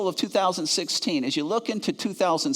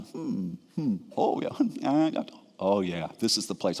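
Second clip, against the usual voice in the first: doctor musing; 7 semitones lower, 5 dB quieter than usual. Retold or imitated. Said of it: "He starts going up and down my spine".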